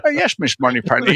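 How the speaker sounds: lightly voiced Connery line, barely shifted; a hint of a suave Scottish spy; half-hearted Bond impression; Sean Connery impression. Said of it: "Can you do a Russian accent?".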